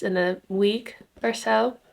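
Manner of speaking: ordinary talk, nothing marked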